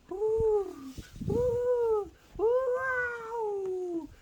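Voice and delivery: high, hollow ghostly wind moan; eerie howling wind sound; spooky wind. Acted. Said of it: "that was a scary sound".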